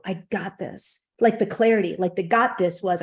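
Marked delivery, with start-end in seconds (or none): 0.1-1.1 softer and slower, settling into calm; quiet and slow, as if reassured. Retold or imitated. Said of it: "I just got calm".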